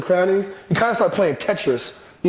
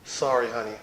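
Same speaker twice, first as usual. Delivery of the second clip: softer, gentle sorry to his wife; tender apology; 7 semitones lower, 5 dB quieter than usual. Pretend. Husband apologizing softly to his wife after an argument, tender. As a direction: soothe her, make it sincere. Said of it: "go to our wife and be like".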